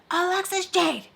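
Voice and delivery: high mock mom calling her full name; stern call. Mom summoning her daughter, a high stern call. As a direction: call her like an angry mother.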